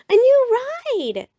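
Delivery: high, loud thrilled squeal; excited shout. A narrator's reading. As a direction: burst out with delight. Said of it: "shouted Sister".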